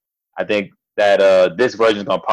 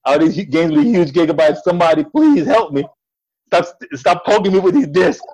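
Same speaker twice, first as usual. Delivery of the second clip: exasperated poked game character; 7 semitones higher than usual. Pretend. Voicing the overworked PS4.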